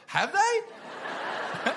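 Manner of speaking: high, incredulous question. Retold or imitated. Girlfriend doubts his claim about everyone, high-pitched disbelief. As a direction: squeak it in mock disbelief.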